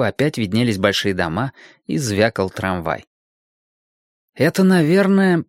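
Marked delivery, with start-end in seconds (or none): 4.3-5.4 high, animated realisation; eager, high-voiced guess; boy working it out. A narrator's reading. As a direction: figure it out aloud, pitched high. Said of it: "сообразил Серёжка".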